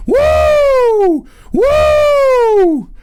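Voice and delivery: high, loud wrestler whoop; strutting Ric Flair style woo. Retold or imitated. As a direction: woo like the Nature Boy. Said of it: "We're doing Ric Flair style".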